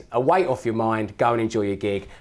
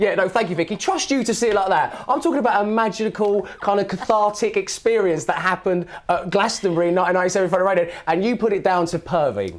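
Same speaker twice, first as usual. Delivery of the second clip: mock offended; 9 semitones higher, 4 dB louder than usual. Real, not acted. Mock offended at host's teasing.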